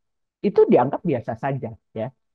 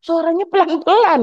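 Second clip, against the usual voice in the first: relative complaining; 13 semitones higher than usual. Retold or imitated.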